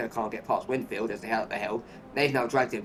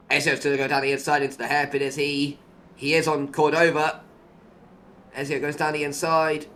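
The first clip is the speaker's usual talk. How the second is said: lively live play-by-play call; motorsport commentator; 3 semitones higher, 5 dB louder than usual. Acted. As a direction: call the overtake as it happens.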